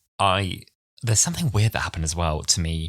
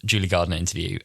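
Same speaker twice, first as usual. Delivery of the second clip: usual speaking voice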